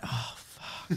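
whispered curse to himself; whispered regret. Retold or imitated. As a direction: breathe it out under your breath in dismay. Re-enacts regretting what he let slip.

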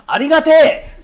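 loud laughing yell at the stage; shouted thanks. Retold or imitated. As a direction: burst out with gratitude as a stage call. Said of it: "そんな掛け声があるか".

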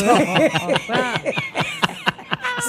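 shrieking laugh; high burst of laughter. Real, not acted. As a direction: squeal with laughter.

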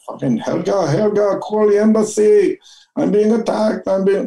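high-pitched, panicked fake Swedish cry for help; mock Swedish accent. Cornered fugitive posing as a Swedish backpacker, mock Swedish accent. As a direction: squeal it in a hammed-up Swedish accent. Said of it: "put on my best Swedish accent".

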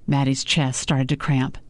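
usual speaking voice

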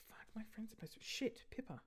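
hushed panicked curse under breath; whispered swearing. Real, not acted. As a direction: whisper the curse in sudden alarm. Sudden realisation, whispered.

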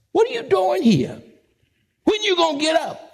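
high, rousing scold of a sleeper; mock exasperation. God rousing the sleeper, high exasperated call. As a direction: call the lazy one out of bed. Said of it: "God's saying".

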